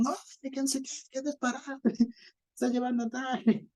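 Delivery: high-pitched shouted complaint at the driver, imitated; mock yelling passenger. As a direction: play the annoyed passenger yelling as she gets off. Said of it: "le gritan".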